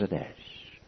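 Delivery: a barely voiced, tearful "it is"; whispered admission. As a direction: whisper it, close to tears. Tearful sister admits quietly.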